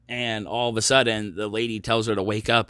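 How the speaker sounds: ordinary talk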